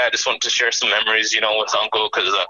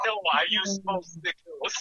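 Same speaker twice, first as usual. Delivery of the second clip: high, slow mock retort; imitating his uncle; 9 semitones higher, 4 dB quieter, slower than usual. Nephew retelling his uncle's joking text reply, mock retort. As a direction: draw out the uncle's comeback in a raised pitch. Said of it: "And he was like".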